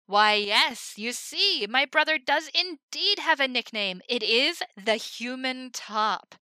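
loud, high ringmaster voice; big carnival presentation; showman patter. Acted. Himmel introducing the Human Top, loud showman voice.

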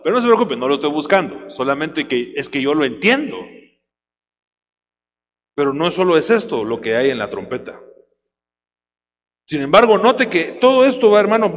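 normal talking voice

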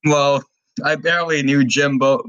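normal talking voice

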